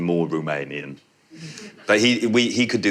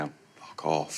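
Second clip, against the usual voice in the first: soft, higher, laughing dismissal; mock send-off; 9 semitones higher, 10 dB quieter than usual. Director voicing the characters' goodbye to the audience, soft amused dismissal. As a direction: quietly tell them to get lost, amused. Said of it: "that's them going".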